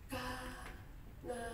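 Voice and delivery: soft, puzzled "Kanaan?" from the ruler; quiet wondering. Acted. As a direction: softly echo "Kanaan?" as a question. Ruler repeats the name, wondering.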